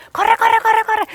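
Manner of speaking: high, loud, urgent shouts of corre; imitating a yelling coach. Coach yelling at nervous kids from the sideline, loud high shouts. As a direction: mimic a coach hollering from the sideline.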